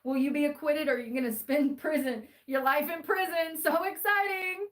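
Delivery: hammed-up sarcastic suspense; sarcastic excitement. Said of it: "I'm being sarcastic".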